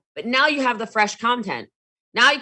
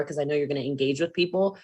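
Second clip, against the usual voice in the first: ordinary talk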